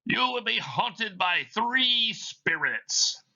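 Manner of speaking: high, slow, hollow ghost voice warning Scrooge; a chain-bound spirit intoning its doom; Marley's ghost. Acted. Reads Marley's ghost in the play.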